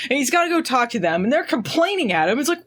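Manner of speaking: ordinary talk, nothing marked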